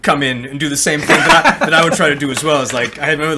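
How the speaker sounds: normal talking voice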